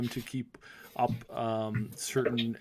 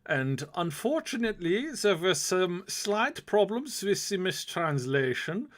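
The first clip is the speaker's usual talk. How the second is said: put-on German accent; 7 semitones higher than usual. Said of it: "I loved your German accent".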